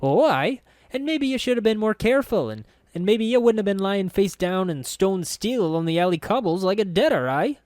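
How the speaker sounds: high, loud scolding lass; scolding girl voice. A narrator's reading. Young woman scolding the narrator, high and loud. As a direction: snap back at him like a sharp-tongued girl.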